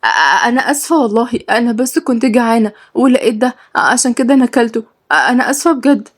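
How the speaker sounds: high, stammering apology; clearly afraid. Acted. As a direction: stumble fearfully over an apology. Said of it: "قالت هي بخوف واضح".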